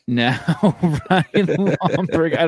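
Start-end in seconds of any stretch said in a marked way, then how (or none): 0.6-2.5 slow laughing name call. Pretend. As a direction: drag the name out through laughter. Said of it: "doing a Ryan Lochte thing".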